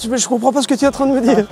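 ordinary delivery

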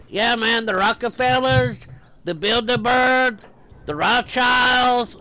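high, loud impression of a worked-up guy; ranting conspiracy theorist. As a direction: stretch out each name in a mock rant. Retold conspiracy rant of California man.

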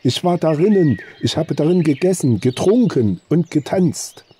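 high, agitated, stretching out at the end; distraught insistence. A narrator's reading. Arnold insists to the hunter he was there, distraught. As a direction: pile up the proof, voice nearly out of control. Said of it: "seiner Stimme selber kaum mehr mächtig".

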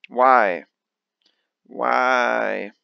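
loud, exasperated lament. Real, not acted. Card player blocked by the deal, exasperated. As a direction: plead with the cards in frustration. Frustrated at the cards.

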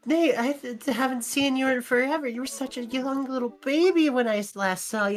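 excited relative. Retold or imitated. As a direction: gush like a long-lost uncle.

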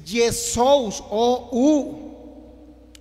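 Yesous slowly, then O U as separate vowels; diphthong sounded out. Shown as a demonstration. Teacher showing the diphthong, Yesous and O U slowly emphasized. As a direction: stretch Yesous and pick out O U. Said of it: "El diptongo en la O y la U".

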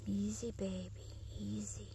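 hushed and gentle, almost silent; soothing whisper. Real, not acted. Whispering to a frightened wild animal to calm it. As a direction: breathe the words softly to calm it.

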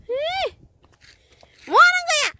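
shrill-free high-pitched loud 뭐 하는 거야; high exasperated exclaim. Real, not acted. Mother exclaims at child.

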